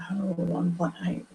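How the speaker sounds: usual speaking voice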